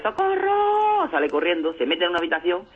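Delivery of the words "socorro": high, stretched-out cry for help. Retold or imitated. Naked man fleeing the mastiff, screaming long and high. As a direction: wail it long and high in panic.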